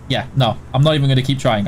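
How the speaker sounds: ordinary talk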